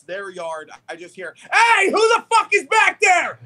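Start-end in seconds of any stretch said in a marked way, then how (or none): 1.5-3.4 neighbor's yell. Retold or imitated. Neighbor yelling from yard.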